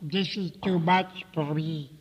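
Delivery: slow, blocked-nose complaint; through the nose. A narrator's reading. Elephant's child with his stretched nose, speaking through it. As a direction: drag it out through a pinched nose. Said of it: "he said through his nose".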